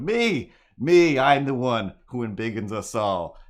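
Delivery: excited heroic cry; heroic shout. Acted. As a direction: shout it out like a proud hero. Cat hero volunteering loudly.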